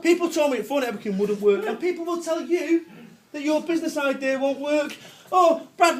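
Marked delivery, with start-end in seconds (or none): 5.3-6.0 mum's voice. Retold or imitated. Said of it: "my mum says to me".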